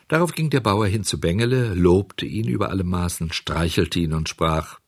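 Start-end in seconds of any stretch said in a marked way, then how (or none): none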